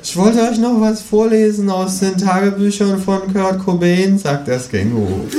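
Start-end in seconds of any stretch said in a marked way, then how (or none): 0.0-4.2 high, cheerful kangaroo voice. A narrator's reading. Kangaroo proposes reading Cobain's diaries, high-voiced. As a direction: offer it brightly, high.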